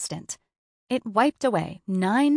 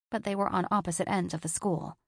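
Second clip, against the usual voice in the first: ordinary delivery, nothing marked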